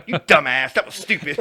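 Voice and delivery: loud, laughing imitation of an exasperated mom; mock scolding. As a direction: scold your dumb son, hammed-up. Mom scolds him in his retelling.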